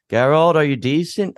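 high playful call into the tent; jokey Jaskier. Retold or imitated. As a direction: tease him like the cheeky bard would. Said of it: "Jaskier's being all, like, jokey".

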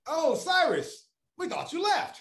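high, slow and sugary, mimicking the interviewer; sweet interviewer voice. Interviewer voiced in retold story.

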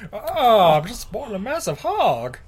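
high, swooping movie-star impression; Jimmy Stewart. Actor brags about himself, comic impression. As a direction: play the star up high, mock-boastful. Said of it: "Jimmy Stewart, is that you?".

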